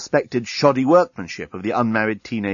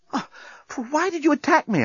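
high-pitched winded protest; groan, then complain in a high voice; wounded high whine; hurt journalist. Acted. Beaten journalist protests after fight.

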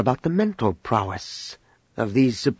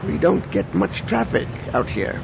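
put-on old man voice; 7 semitones higher, 3 dB quieter than usual.

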